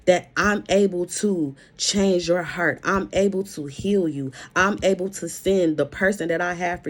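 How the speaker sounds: God's promises, preached firmly with steady emphasis; voicing God. Preacher voicing God's promises to the listener, firm and emphatic. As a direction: speak as God promising new doors. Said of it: "This is what God is saying".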